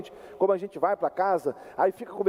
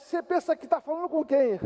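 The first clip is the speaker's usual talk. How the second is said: esposa indignada; 7 semitones higher than usual. Retold or imitated. Imitates wife confronting the man.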